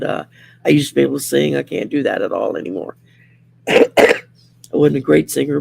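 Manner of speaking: usual speaking voice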